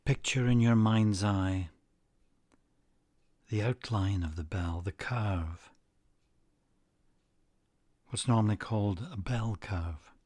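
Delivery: unhurried guided-relaxation delivery; guided meditation. Acted. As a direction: lead listeners calmly, phrase by phrase, leaving space after each.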